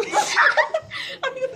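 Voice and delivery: high burst of laughter; laughing. Real, not acted. Host reacts to a garbled guess, laughing.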